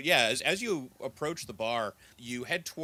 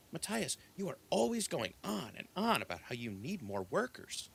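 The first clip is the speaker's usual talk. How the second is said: exasperated scolding; 5 semitones higher, 4 dB quieter than usual. Acted. Old elf woman scolds young elf.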